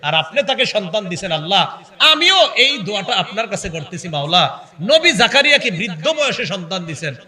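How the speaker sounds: impassioned model supplication; tearful plea, acted. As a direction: press the plea urgently to God. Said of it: "চোখের পানি ছেড়ে এই কথাটা বলেন".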